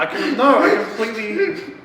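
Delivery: high, loud, broken-off protest; flustered laughing. Real, not acted. Storyteller caught forgetting the key plot point, flustered. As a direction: stammer upward and dissolve into real laughter.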